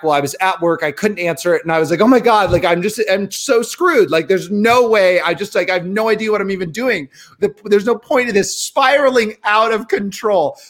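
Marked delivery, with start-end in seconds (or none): 2.0-10.6 high-pitched frantic retelling; breathless mock despair; panicked. Retold panic spiral.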